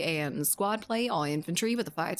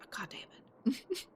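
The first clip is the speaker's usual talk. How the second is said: a slow, breathy whispered curse under the breath; 4 semitones higher, 7 dB quieter, much slower than usual. Real, not acted. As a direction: hiss it to yourself in frustration. Frustrated aside, whispered.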